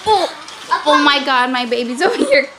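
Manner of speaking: normal talking voice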